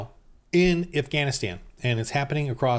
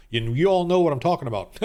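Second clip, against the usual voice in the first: normal talking voice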